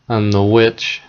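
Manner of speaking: plain reading voice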